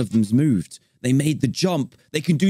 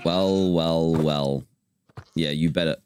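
low, drawn-out mock villain taunt; a hint of a gloating villain; mock menace; 10 semitones lower, slower than usual. Player taunting the baby goats, low mock gloating.

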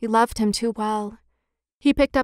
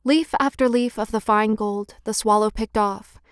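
plain reading voice

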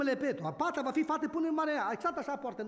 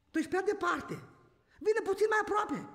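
raised plea; 8 semitones higher than usual. Real, not acted. Preacher pleading with the congregation.

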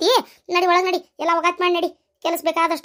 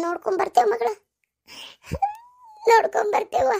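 tearful question, pitched higher; 6 semitones higher than usual. Acted. Daughter to mother, about visits after she leaves, tearful. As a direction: ask it through tears. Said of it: "ಅಳ್ಬೇಡ ನನ್ ಮಗಳ".

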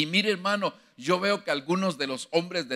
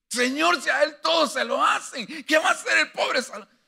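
high, loud, pleading cry to the Lord; anguished plea; 13 semitones higher, 4 dB louder than usual. Retold or imitated. David begging God for Solomon, high loud plea. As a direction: cry out for your helpless son.

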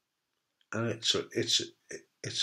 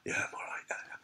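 breathless, whispered reply; barely any air behind the words; out of breath; 14 dB quieter, faster than usual. Retold or imitated. Bald patient on the couch, breathless whisper. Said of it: "out of breath".

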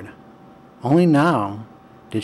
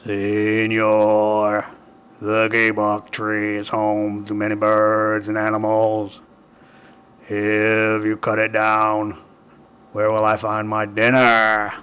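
growling jaguar. A narrator's reading. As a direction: growl it like a big cat. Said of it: "He growled into his ear".

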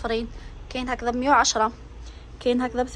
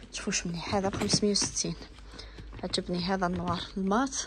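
soft unvoiced whisper of the price; whispered aside; 3 semitones lower, 6 dB quieter than usual. Real, not acted. Shopper whispering a bag's price to followers, hushed whisper. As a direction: lean in and whisper it to viewers. Aside about a bag in the shop.

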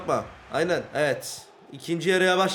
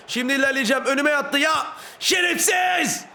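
outraged yelling; 8 semitones higher than usual. Real, not acted. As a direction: explode at the player who blocked you. Opponent blocks his run. (öfkeyle, giderek yükselerek bağırma)

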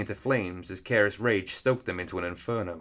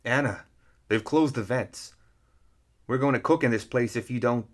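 lightly voiced urgent character warning; a hint of an urgent plea to a foe; character dialogue, pressing to be heard; Tony urging a warning; 3 semitones higher than usual. A narrator's reading. Tony's urgent warning to opponent.

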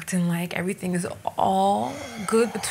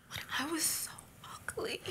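much softer, breathy; ashamed whisper; 4 semitones higher, 16 dB quieter than usual. Acted. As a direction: whisper it in shame. Ashamed self-reproach.

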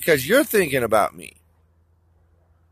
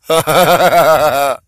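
a big burst of laughter, louder; 9 dB louder than usual. Real, not acted. Amused by his own parody, loud laugh.

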